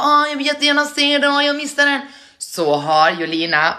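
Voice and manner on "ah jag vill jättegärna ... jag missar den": high, sustained whiny fan voice; mock lament, pitched way up; disappointed viewer. Imagined fan regretting a missed livestream, high mock whine. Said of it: "och du bara".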